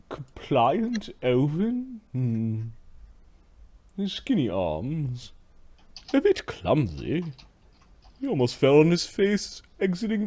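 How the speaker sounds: haughty slave master. Acted.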